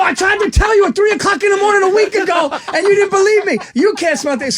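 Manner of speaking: high loud shout of vindication; vindicated shout. Retold or imitated. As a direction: yell it, finally proven right. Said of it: "And I go".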